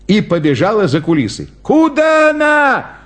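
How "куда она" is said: high, stretched alarmed call; clown's cry. A narrator's reading. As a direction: cry out high after the runaway dog. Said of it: "закричал клоун". (высокий окрик клоуна)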